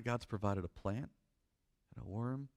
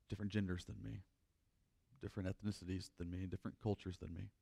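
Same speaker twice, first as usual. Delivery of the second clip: quiet reflection; 4 semitones lower, 6 dB quieter than usual. Acted. Pastor confessing to the congregation, softly and unhurried. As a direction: draw them in softly, unhurried.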